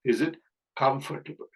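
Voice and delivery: "comfortable" with a short reduced "tuh-bul"; correct pronunciation. Shown as a demonstration. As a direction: model "comfortable" as KUM-fur-tuh-bul, after a pause. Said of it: "கம்ஃபர்டபிள் தான் சரியான உச்சரிப்பு". ("comfortable" என்பதில் ட குறிலாக)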